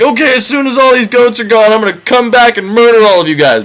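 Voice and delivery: high, mock-menacing giant voice; threatening cyclops. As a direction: promise murder in a high sing-song. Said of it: "and he's like".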